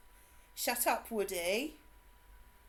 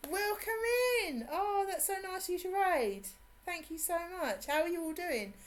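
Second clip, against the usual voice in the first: excited welcome; 9 semitones higher than usual. Real, not acted. Streamer welcomes the raiders.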